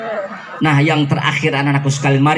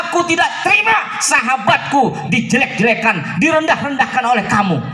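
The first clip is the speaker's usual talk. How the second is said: angry shout; 10 semitones higher than usual. Acted. Umar shouts at the beggar defending his friend, indignant yell. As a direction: yell it in outrage for your friend.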